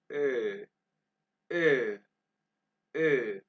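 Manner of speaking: held "ए" through rounded lips, like ö; rounded German umlaut O. Shown as a demonstration. Teacher modelling O with two dots, rounded vowel. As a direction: round the mouth while saying a long "ए". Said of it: "मुंह को गोल करेंगे".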